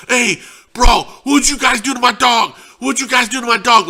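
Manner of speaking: high, loud, demanding yell; accusing shout. Retold or imitated. Guy confronting the diners about his friend, shouting accusingly. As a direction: shout the question at the table like an accusation.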